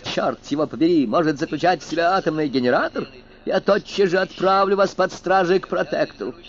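swelling from measured pride to fury; indignant outburst. Acted.

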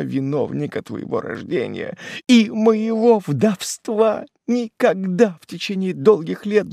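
high, loud tearful wail; keen for a dead son, voice pitched high; anguished, wailing outpouring; grief-stricken mother's lament. A narrator's reading. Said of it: "Голос её порвался. Она горько зарыдала".

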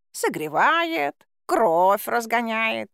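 slow smug cat voice. A narrator's reading. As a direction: draw it out like a self-satisfied cat. Cat's smug reasoning.

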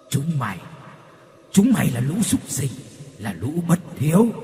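hollow ghostly curse ending in cackle; cackling ghost. Acted. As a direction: spit contempt then cackle. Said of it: "cười lên khanh khách". (hồn ma chửi rồi cười khanh khách)

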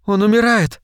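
high-pitched, urgent cry without extra volume; alarmed exclamation. A narrator's reading. Tina sees the alien bleeding out, alarmed exclamation. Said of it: "воскликнула Тина".

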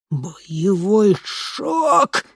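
slow growled words; growling. A narrator's reading. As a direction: growl it through clenched rage. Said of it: "рычал Микки".

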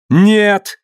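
very high, drawn protesting cry; high wild cry. A narrator's reading. Mary Lee stops the stoning, high wild cry. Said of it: "услышал он высокий дикий крик".

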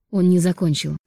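plain reading voice

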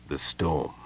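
low, soft murmur. A narrator's reading. Parlin recalls the storm beside Tass, murmuring. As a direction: murmur it low, half to yourself. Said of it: "Parlin murmured".

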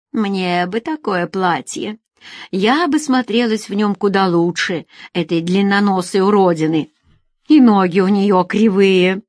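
ordinary delivery, nothing marked

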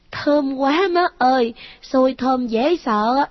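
put-on high child voice, slow and savoring; greedy ghost child. A narrator's reading. Ghost boy relishing food, slow high child voice. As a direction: savor the smell of the sticky rice. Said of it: "liếm môi hít hít".

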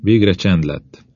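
ordinary delivery, nothing marked

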